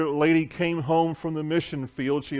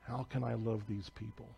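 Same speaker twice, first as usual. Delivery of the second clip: hushed prayer; 2 semitones lower, 11 dB quieter than usual. Retold or imitated. Missionary pleading with God about her neighbors, hushed. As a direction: ask it under your breath, as if before God.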